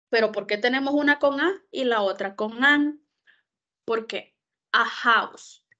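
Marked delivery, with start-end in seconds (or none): 4.7-5.7 "house" con la H sonando como J. Shown as a demonstration. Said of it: "Esa H se pronuncia como una J". ("A house" con la H pronunciada como jota)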